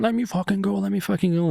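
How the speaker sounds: high-pitched mock struggle; imitated angry protest. Thief pinned on the ground, high mock protest.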